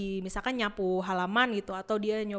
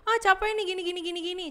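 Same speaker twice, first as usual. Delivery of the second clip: high-pitched whiny grumble; hammed-up whinge, pitched way up; mock complaining; 9 semitones higher, faster than usual. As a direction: act out a worker moaning on and on. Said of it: "kalau kerja ngeluh-ngeluh gitu kan".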